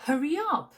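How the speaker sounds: "Hurry up" joined as "hurry-yup"; linked words. Shown as a demonstration. Teacher shows the liaison in Hurry up, linked. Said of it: "en faisant bien la liaison".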